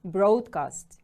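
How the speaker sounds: "Broadcast" punched on BROAD; first-syllable stress. Shown as a demonstration. Teacher models the word for pupils, "Broadcast" stressed firmly on BROAD. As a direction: hit the start of "Broadcast" firmly: BROAD-cast. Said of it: "Stress is on the first syllable".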